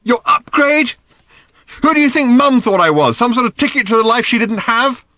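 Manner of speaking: high, loud, furious demand; demanded angrily. A narrator's reading. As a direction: shout it at him, hurt and raging. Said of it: "she demanded angrily".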